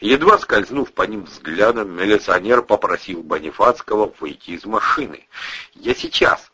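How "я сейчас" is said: cheerful. Acted. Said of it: "бодро сказал Боник".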